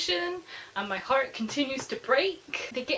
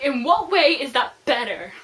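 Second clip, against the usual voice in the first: loud, incredulous "ew" outrage; incredulous disgust; 2 semitones higher, 9 dB louder than usual. Retold or imitated. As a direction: throw it back in disbelief. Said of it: "Cassie's like".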